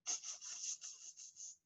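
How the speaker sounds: soft unvoiced tss tss; whispered monkey chatter. A narrator's reading. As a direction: click like cheeky monkeys. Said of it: "and said".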